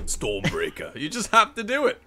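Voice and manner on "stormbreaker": low-pitched impression voice; Dinklage. Host proving he can't say it normally, Dinklage voice. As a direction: drop deep for the one word. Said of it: "can't say it without doing the voice".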